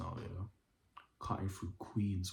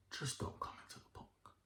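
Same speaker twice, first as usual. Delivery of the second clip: hushed, voiceless word of advice; whispered warning; 10 dB quieter than usual. Real, not acted. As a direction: drop to a whisper to warn the listener.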